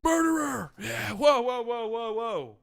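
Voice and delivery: mock crowd yelling at the pick; rowdy fans. Playing the upset fans reacting to the pick, mock yelling. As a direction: play the angry fans, then cheer. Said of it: "Calm down, fans".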